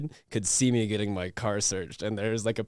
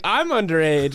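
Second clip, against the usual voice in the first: loud, high retold disbelief; indignant protest; 6 semitones higher, 7 dB louder than usual. Replaying his protest to the officers, indignant. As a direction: act out protesting an absurd charge.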